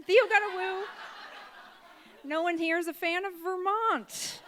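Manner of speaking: high-pitched mock protest; mock indignation. Comic chiding the audience for not cheering Vermont, mock indignation.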